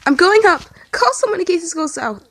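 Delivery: frantic shout back to the friends; screaming. A narrator's reading. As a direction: yell it while rushing off. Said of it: "screaming over his shoulder".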